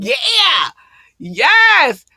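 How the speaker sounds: high, loud celebratory yell; hype shout. Real, not acted. Host cheering five years in the game, hyped shouting. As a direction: shout it in triumph. Host hyping her own anniversary.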